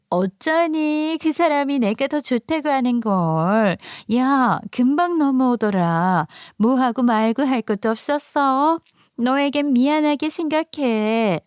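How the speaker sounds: high, drawn-out mock pity; gloating best friend. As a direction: rub it in with a high, coy lilt.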